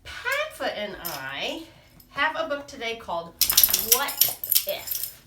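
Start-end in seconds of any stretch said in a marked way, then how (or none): none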